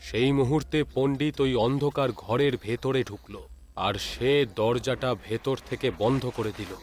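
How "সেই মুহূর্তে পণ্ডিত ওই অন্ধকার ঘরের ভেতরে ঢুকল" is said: ordinary delivery, nothing marked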